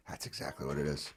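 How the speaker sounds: low, very soft acted epiphany; quiet realization. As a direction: let it dawn on you under your voice. Acted client's realization in therapy.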